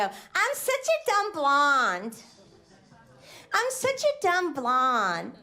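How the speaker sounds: slow, airheaded ditzy voice; slow mocking ditz impression; mock dumb blonde. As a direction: play the dumb blonde, drawn out. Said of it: "when they go".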